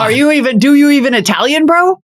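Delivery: high-pitched incredulous ribbing, rising to "bro"; mock outrage. Listener mock-challenges a caterer who forgot the steak, high incredulous teasing.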